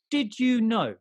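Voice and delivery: high, loud put-on announcer voice; jingle-announcer voice. Co-host introduces his amazing fact in a showy announcer voice. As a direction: belt out the segment title. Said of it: "say it in that type of voice".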